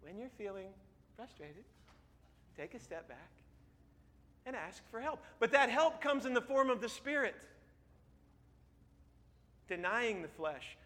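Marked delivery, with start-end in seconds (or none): 0.0-5.2 soft, halting, hushed counsel; gentle advice. Retold or imitated. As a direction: pause between phrases, very softly. Mother's advice repeated gently to congregation.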